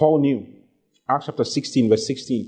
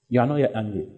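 usual speaking voice

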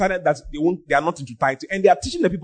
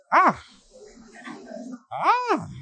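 two high exclamations, the second higher still; shocked "ah"; 8 semitones higher than usual. Real, not acted. Preacher reacting to someone swallowing a fish bone, shocked. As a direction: gasp out "ah" in rising alarm.

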